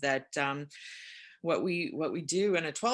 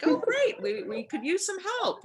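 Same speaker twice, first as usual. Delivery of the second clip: much higher, bright mock enthusiasm; chirpy Rebecca imitation; 5 dB louder than usual. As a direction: squeak it out like an overeager helper. Voicing Rebecca's eager reply.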